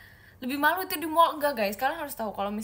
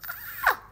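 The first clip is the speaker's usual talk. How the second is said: short, very high-pitched squeal; startled squeal. Real, not acted. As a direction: let out a high little yelp. Reacting to puzzling chat messages.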